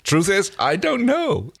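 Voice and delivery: high, slow put-on shrug; imitating Crichton.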